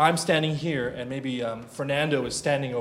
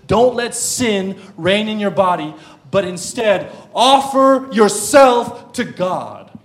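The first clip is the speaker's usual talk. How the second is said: loud, raised, stretched preaching; forceful exhortation; 5 semitones higher, 9 dB louder, slower than usual. Real, not acted. Preacher urges Christians to flee sin, forceful exhortation. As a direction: preach it urgently at full force.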